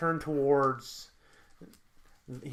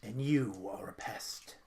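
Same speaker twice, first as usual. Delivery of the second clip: slow, low, quiet menace; hushed villain staking his claim; soft, drawn-out contempt; possessive villain; 4 semitones lower, 9 dB quieter, slower than usual. Acted. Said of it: "Pietro looks at him and goes".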